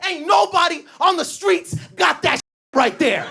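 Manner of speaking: high mock hustler, pushed harder; a high, pushy street hustle; turned-up hustle. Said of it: "he turns it up".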